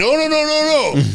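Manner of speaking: a very high, loud, panicked-sounding no; hammed-up frantic backpedal; squeaky mock denial. Customer caught out, denying it in a high squeaky panic. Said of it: "He's like".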